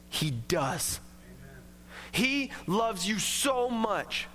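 reassuring. Real, not acted. Pastor gently reassuring doubting listeners, low and slow. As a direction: gently assure them they are loved.

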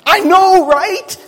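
high, loud and thrilled; excited gushing. Pretend. Preacher voicing Mary's excited reply, high and loud. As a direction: gush it like excited girlfriends. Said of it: "It's like".

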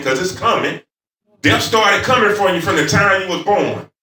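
high, loud pulpit proclamation; forceful preaching. Real, not acted. Preacher warning the congregation that death is coming, forceful preaching. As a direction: ring it out as an urgent warning.

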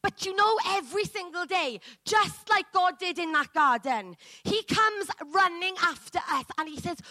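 ordinary delivery

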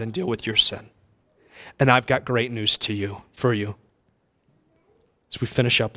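soft, low preaching; quiet altar call. Acted. Preacher quietly calling the congregation to repent. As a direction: invite them gently toward God.

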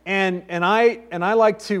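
normal talking voice

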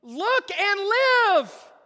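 high, drawn-out shout; crying out to a crowd. Acted. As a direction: call it out long and loud over the crowd. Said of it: "walking through the crowd saying".

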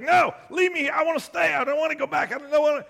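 high, loud, kicking and screaming son; screaming protest. Retold or imitated. Son being dragged home screams in protest, loud and high. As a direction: yell it like a dragged-off kid. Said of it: "He's kicking and screaming".

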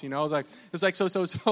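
ordinary talk, nothing marked

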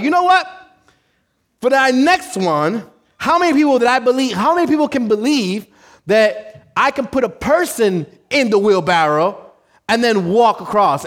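high, loud, hyped-up challenge; hyped showman. Acted. Tightrope walker dares crowd to believe, hyped. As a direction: fire up the crowd for a bigger stunt. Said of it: "he's hyped".

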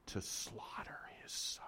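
hushed whisper on the dreadful words. Acted. Pastor reading the sacrifice verse, whispered. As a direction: breathe it out in horror. Dark climax of scripture reading.

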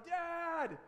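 put-on high, loud kid's yell; imitated yelling. Three-year-old son who won't come in, yelling for dad. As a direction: holler for dad like a small child. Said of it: "he keeps yelling".

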